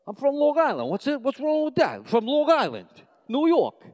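high-pitched, hammed-up Long Island accent; heavy New York accent. Young New Yorker answering Oklahoma classmates, heavy New York accent. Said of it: "this really heavy New York accent".

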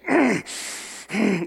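short throaty snort of anger; angry snort. Retold or imitated. Preacher shows Jesus groaning at Lazarus's death, angry snort. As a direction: snort like someone deeply troubled. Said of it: "It's actually to snort in anger".

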